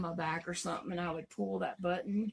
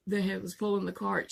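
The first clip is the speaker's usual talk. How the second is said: normal talking voice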